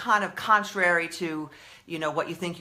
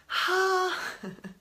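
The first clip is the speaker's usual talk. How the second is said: long breathy exhale of relief; heavy sigh; 11 semitones higher, 4 dB quieter than usual. Real, not acted. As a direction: let out a big tired sigh. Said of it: "I need to breathe".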